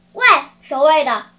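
loud, commanding shout; booming order from the puppet master; calling the guards. Acted. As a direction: summon the guards sharply. Puppet master calls his guards.

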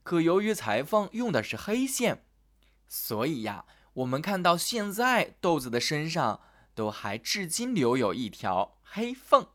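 ordinary delivery, nothing marked

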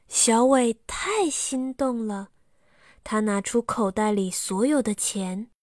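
usual speaking voice

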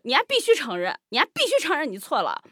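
heated mock demand for an apology; retold defiant demand. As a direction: re-enact standing your ground stubbornly. Re-enacts refusing to back down.

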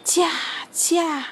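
drawn-out driver's call; calling to reindeer. A narrator's reading. Santa driving his reindeer, urging call. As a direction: urge the team on, rising.

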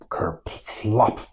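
plain reading voice

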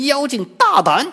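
very high, loud shout; Wukong's battle cry. A narrator's reading. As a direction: roar at the demon. 悟空 stops the demon. (悟空厉声大喝)

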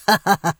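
short, bitter sneering laugh; cold laugh through gritted teeth; scornful "ha ha ha". A narrator's reading. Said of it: "咬牙切齿地冷笑道".

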